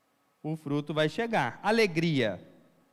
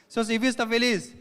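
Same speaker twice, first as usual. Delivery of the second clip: quizzing call; 6 semitones higher, 6 dB louder than usual. Acted. Preacher quizzing the congregation.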